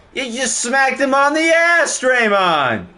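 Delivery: playful call-out; teasing. Real, not acted. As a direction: rib Draymond over the foul. Mocking a foul.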